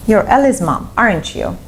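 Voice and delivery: tag "aren't you" dropping in pitch at the end; falling intonation on "aren't you". Shown as a demonstration. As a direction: say "aren't you" as a confirmation, voice going down. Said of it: "if you use falling intonation".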